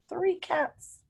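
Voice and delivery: usual speaking voice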